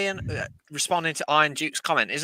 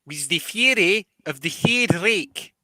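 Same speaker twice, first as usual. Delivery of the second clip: South African accent; 4 semitones higher than usual. Shown as a demonstration. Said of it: "That's a South African accent".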